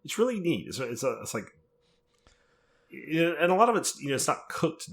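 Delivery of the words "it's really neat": impressed quote. Retold or imitated. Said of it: "I was like".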